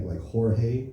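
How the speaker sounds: "Jorge" said "Hor-heh" with an H; Spanish J as H. Shown as a demonstration. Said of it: "the G makes an H sound".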